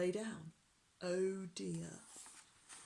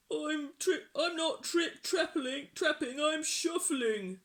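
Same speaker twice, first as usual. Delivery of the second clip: high, squeaky scared troll; very small voice; 8 semitones higher, 6 dB louder than usual. A narrator's reading. Troll answers jeering pirate, small high voice. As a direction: squeak it out, stumbling. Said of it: "in a very small voice".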